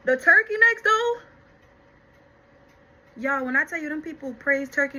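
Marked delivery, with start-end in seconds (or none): 0.1-1.2 high, loud rave about the food; excited gush. Real, not acted. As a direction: burst out in excitement, much higher. Gushing about jail turkey necks.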